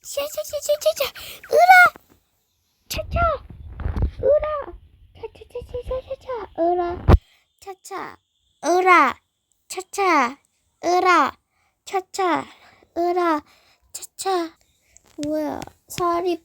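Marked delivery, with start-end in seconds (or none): 0.0-1.1 high rapid sha-sha effort noise; high hissing sha sound. Acted. 1.5-14.6 high shouted heave-ho chant; effort shouts. Acted. Lifting weights, effort shouts.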